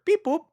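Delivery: high chirpy robot beep; squeaky machine noise; beep boop sound. Pretend. Sound effect while showing image.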